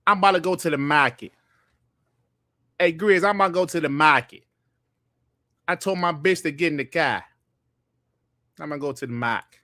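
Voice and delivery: put-on Boston accent with dropped r's. Shown as a demonstration. Streamer demonstrating the Boston accent for listeners, in a broad Boston accent. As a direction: do a broad Boston "market" and "car". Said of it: "the Boston accent is like this".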